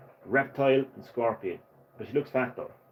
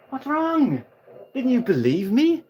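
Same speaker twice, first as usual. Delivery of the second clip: mocking taunt; 12 semitones higher, 5 dB louder than usual. Still gloating over the beaten Kentaro, higher mocking taunt. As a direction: rub the loss in with a high sneer.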